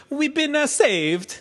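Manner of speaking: high, sing-song mock Italian priest; Italian accent. Retelling the rescued priest's line, mock Italian accent. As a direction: put on a comic Italian accent. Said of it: "Italian priest".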